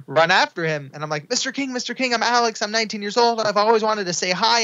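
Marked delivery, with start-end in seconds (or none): 1.3-4.6 high, breathless star-struck gushing; excited young fan meeting his idol; eager fan greeting. Retold or imitated. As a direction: rush it out like a nervous fan.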